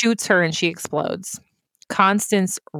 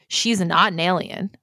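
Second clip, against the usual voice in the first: usual speaking voice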